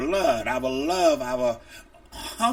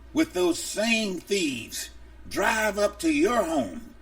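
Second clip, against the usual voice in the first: ordinary delivery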